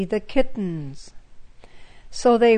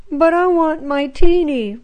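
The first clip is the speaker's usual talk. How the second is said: put-on a higher, louder childish wail; 5 semitones higher, 5 dB louder than usual. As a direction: plead for the lost kitten in a wail. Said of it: "wailed Frida".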